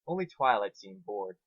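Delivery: ordinary talk, nothing marked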